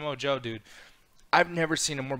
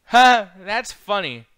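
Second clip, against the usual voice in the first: slow, loud, high mock laugh; imitating Kurt Angle's forced laugh; Kurt Angle's fake laugh; 7 semitones higher, 11 dB louder, much slower than usual. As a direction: ham up a fake chuckle. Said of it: "Kurt Angle goes".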